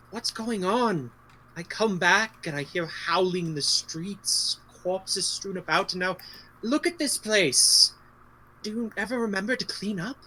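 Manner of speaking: stretched, emphatic in-character reproach; concerned returning friend. Acted. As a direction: question them with theatrical concern. Player in character scolds the host about town.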